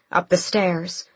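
ordinary delivery

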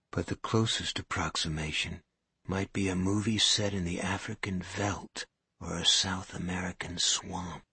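usual speaking voice

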